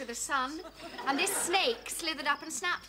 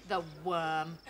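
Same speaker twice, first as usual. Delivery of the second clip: ordinary delivery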